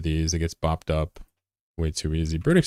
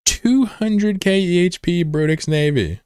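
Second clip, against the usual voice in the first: high, slow exaggerated price call; mock excited; 11 semitones higher, 3 dB louder, slower than usual. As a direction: stretch it out like a hype call.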